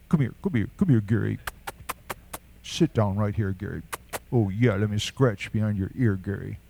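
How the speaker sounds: lightly voiced dog owner, trailing low; talking to a pet dog. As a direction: coax a dog over as if to pet it. Plays owner fussing over pet dog.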